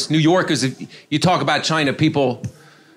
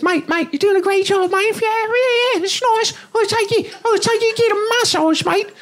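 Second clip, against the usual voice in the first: high squeaky Australian bloke, much higher; little Aussie promoter in a tiny high voice; tiny excited Australian promoter voice; Australian accent. Retold or imitated. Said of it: "this little Australian guy".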